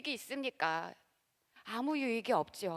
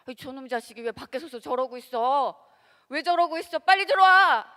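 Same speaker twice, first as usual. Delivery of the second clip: exasperated parent; 2 semitones higher, 10 dB louder than usual. Retold or imitated. Parent yells at child standing outside the door: rising shout. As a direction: scold the kid to get inside now.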